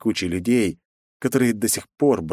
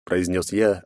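ordinary delivery

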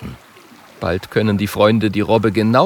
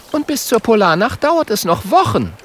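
high, chiding character voice; high-pitched little know-it-all; know-it-all girl figure; 12 semitones higher than usual. A narrator's reading. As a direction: correct the boys in a high voice.

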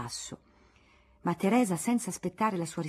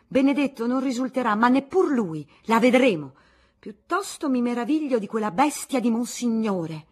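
indignant rant; 5 semitones higher, 8 dB louder than usual. Acted. Aunt ranting against Monsignore.

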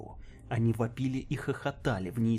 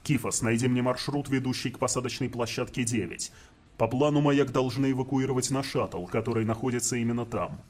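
stern commander voice; 4 dB louder than usual. Acted. Commander giving orders.

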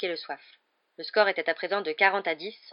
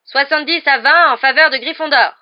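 loud, higher score announcement; match commentator; 8 semitones higher, 15 dB louder than usual. Acted. Commentator announcing the score to the crowd, loud. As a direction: call the score across the stadium.

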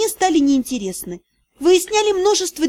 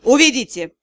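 barked. A narrator's reading. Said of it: "рявкнул он".

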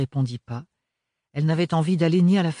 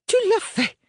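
much higher, loud accusing cry; furious exclamation; 5 dB louder than usual. A narrator's reading. Mother catching her daughter out, furious outburst. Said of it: "s'exclama furieusement".

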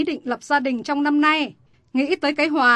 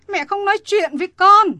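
angry retort; 7 semitones higher, 7 dB louder than usual. Acted. Mother scolds defiant daughter.

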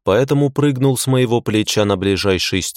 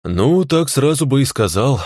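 weary sigh; 5 semitones higher than usual. Acted. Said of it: "вздохнул я".

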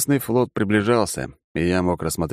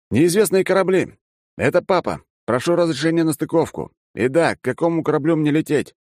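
high-pitched calling voice; raised, projected pilot's call; radio hail; 9 semitones higher than usual. Acted. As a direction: hail the ships as a pilot asking to dock. Pilot hails unknown ships by radio.

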